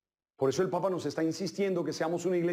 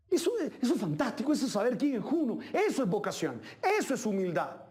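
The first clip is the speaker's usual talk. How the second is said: ordinary delivery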